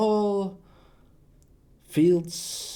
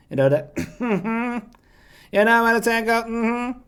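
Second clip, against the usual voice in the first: higher-pitched sassy voice; 5 semitones higher, 4 dB louder than usual. Shown as a demonstration. Showing off the sassy one, sassy voice. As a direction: put on sass, seeking agreement. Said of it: "I also got the sassy one".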